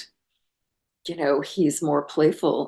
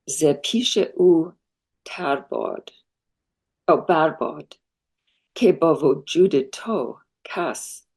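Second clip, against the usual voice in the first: slow, careful Persian recited with a hesitant non-native accent; Persian recitation; 4 dB louder, slower than usual. Shown as a demonstration. Guest reciting a Hafez ghazal line for the host, slow and careful. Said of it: "my Persian accent is terrible".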